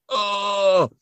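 high, hurt cry as he's shot; mock pained cry. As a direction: act out a gunshot victim's groan. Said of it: "And he's like".

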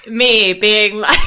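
ordinary delivery

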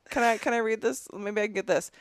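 normal talking voice

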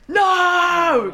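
high, loud shocked "No!"; shocked cry. Retold or imitated. Gogglebox viewers reacting to the twist ending: high shocked shout. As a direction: yell it like a viewer stunned by the ending. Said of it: "they're like".

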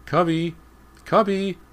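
very high, loud calls; calling out. A narrator's reading. Boy hunting his lost dog, calling out. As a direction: yell for the dog.

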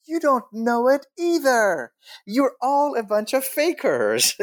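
high, indignant mock outburst; comic indignation, voice pushed high; mock accusation. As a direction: call them out in a high-pitched protest. Acted accusation at fellow students.

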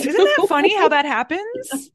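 high and loud, amused; amused exclaim. Real, not acted. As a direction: tease her with delight. Amused teasing remark.

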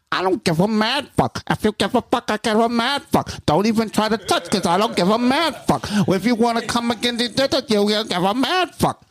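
really high rapper voice freestyling. Retold or imitated. Imitating a hated friend's freestyle, really high rapper voice. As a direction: imitate a high-pitched [name] flow. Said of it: "in this really high [name], be real kind of voice".

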